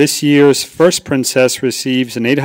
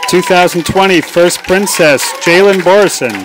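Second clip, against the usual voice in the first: loud, raised announcer call; announcing the winner; 5 semitones higher, 6 dB louder than usual. Real, not acted. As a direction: build it up and call the name out.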